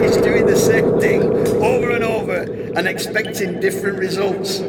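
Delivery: put-on high, loud Austrian-accent yell; shouting. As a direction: belt it out like a nightmare. Nightmare climax shout.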